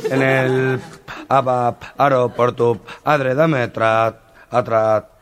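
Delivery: slow, stammering, repeating broken syllables; stutterer. Retold or imitated. Stutterer pleading with the owner for a job, stammering. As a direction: stumble hard over every word. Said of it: "el tartamudo".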